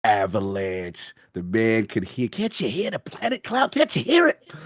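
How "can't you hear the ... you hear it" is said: high, impassioned imitation of a game character; game character quote. Fan reenacting an iconic elevator scene, heightened character voice.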